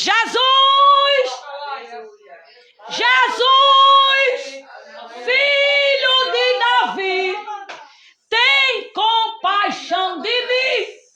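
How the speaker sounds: high, slow, drawn-out shout of a name; desperate cry. Acted. Woman begging Jesus for mercy, crying out high and slow. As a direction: cry out to Jesus as if from far back in the crowd. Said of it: "E ela mete o grito".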